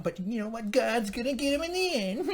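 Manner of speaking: high-pitched mock consolation; exaggerated, squeaky-high righteousness; mocking piety. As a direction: parody a pious promise way up high. Mockingly voicing a pious consolation.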